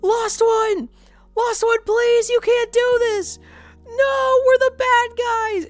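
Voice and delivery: high, loud, desperate begging scream; pleading shout. Retold or imitated. Player begging Lost One to spare trainees, shrieked high and loud. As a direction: shriek it as if begging a companion to stop killing. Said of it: "And I was like".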